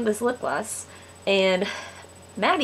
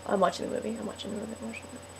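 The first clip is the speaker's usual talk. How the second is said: soft mutter fading to a near whisper; imitated muttering to oneself; hushed self-distraction; 11 dB quieter, much faster than usual. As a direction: repeat it under your breath, fading away. Friend pretending not to see.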